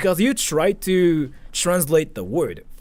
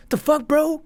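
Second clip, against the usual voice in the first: much higher, incredulous; shocked exclamation; 12 semitones higher than usual. Acted. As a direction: react as if you can't believe it. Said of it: "But we say it like".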